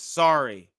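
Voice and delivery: normal talking voice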